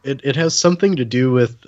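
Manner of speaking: ordinary delivery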